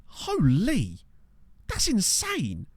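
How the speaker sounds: high, excited exclamation of disbelief; real shock at what you see; amazed. Real, not acted. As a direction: blurt it out, genuinely stunned. Shocked at a player's stats.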